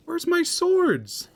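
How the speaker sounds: high, slow, stretched-out cry; disbelieving wail. Real, not acted. Player wailing after losing his swords in a fight. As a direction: drag it out in a high plaintive wail.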